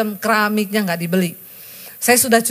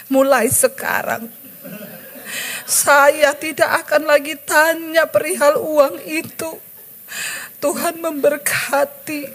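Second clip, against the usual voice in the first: tearful, slow; 6 semitones higher, slower than usual. Retold or imitated. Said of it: "Dengan bercucuran air mata".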